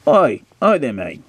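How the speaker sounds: hammed-up Cockney accent, high and loud; [name]. [name] hailing a stranded driver, mock Cockney accent. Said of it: "Doing my Cockney accent".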